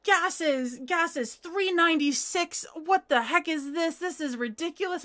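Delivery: hammed-up irate gas-station guy; imitating an angry stranger. Guy at the pump complaining about gas prices, mock angry rant. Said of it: "he was cursing".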